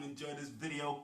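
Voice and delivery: ordinary talk, nothing marked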